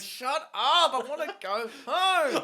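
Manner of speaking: high, loud whiny mock heroine; mock fed-up heroine. Heroine to monologuing villain, high whiny complaint. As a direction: whine it like she's done with him.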